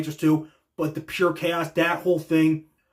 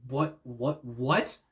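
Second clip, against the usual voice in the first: quiet, low questions rising at the end; hushed, bewildered repetition; stunned disbelief; 3 semitones lower, 6 dB quieter, much slower than usual. Retold or imitated. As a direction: mimic dazed confusion, softly. Voicing Danielson's stunned face.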